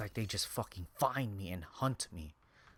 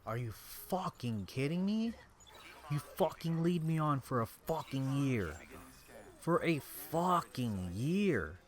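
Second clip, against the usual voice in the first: higher, indignant, hard on the swearing; angry disbelief; 5 semitones higher than usual. Real, not acted. Confronting the absent ex who led them on, angry disbelief.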